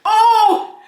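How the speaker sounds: high, loud laughing "oh!"; shocked whoop. Real, not acted. Host reacts to Aiden answering for her, whooping. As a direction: cry out in delighted outrage.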